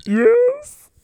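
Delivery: hammed-up falsetto sob; crying character. Mister Golf admits to the host he is crying. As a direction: squeak it out through tears. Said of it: "are you already crying".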